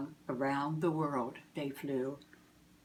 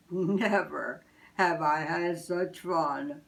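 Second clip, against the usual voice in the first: happy Santa. Acted. Santa telling Missus Claus, slow and happy. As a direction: savour the fun.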